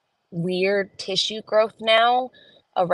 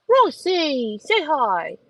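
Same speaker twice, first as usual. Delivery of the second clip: higher, sing-song coaxing; coaxing the dog; 8 semitones higher, 4 dB louder, much slower than usual. Real, not acted. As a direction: sweetly urge the dog to greet.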